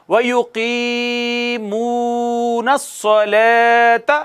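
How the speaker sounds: वयुकीमूनस्सलात with all three long vowels stretched equally, very slow; even drawn vowels. Shown as a demonstration. Teacher reciting so students hear equal lengthening, slow chant. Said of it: "फिर से देखिए".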